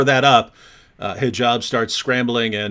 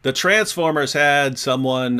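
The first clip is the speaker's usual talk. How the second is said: ordinary delivery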